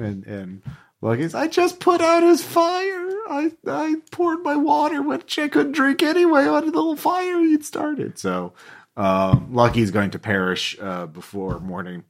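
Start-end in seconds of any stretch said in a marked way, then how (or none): 1.3-8.2 put-on high, whiny kid protesting; indignant kid. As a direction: plead your innocence like a wronged child. GM voices Lucky's protest.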